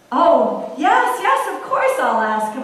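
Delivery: loud, eager promise; eager loud reply. Acted. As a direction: burst out with eager yes.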